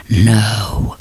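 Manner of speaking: very low menacing growl of No. Pretend. Reader imagining the hero growling at heroine, deep growl. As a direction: drop deep and growl it at her. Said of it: "did he look at her and go".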